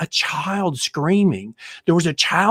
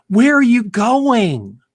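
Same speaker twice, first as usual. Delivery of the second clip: calling out higher and louder, drawn out slowly; alarmed call; 7 semitones higher, 5 dB louder, slower than usual. Acted. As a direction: call after him, startled he's walking off. Wife calls after leaving husband.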